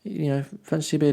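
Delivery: high-pitched, tentative chat-up; imitating a friendly invite, pitched right up; friendly invitation. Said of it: "just being generally friendly".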